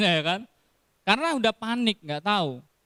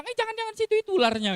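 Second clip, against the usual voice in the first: quick warning; much faster than usual. Retold or imitated. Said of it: "Coba kalau dia bilang".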